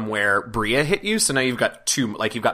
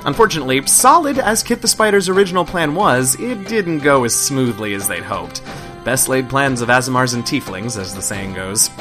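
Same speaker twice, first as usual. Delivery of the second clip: narration; 2 semitones higher, 5 dB louder than usual. A narrator's reading. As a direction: lively recap narration. Game master narration recap.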